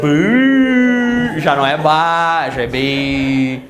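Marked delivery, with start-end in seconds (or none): 0.0-1.4 pained sympathetic bu. Shown as a demonstration. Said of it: "tu faz o quê?".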